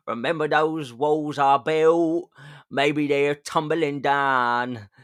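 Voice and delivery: slow, hammed-up British accent; mock British pop-star impression. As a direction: drag out the broad British vowels. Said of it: "with a British accent".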